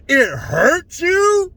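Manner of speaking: slow, drawn-out high disbelief; incredulous. Real, not acted. Comedian mocking the pundit's claim of hurt, drawn-out disbelief. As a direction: stretch it out, you can't believe it. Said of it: "incredulous echo".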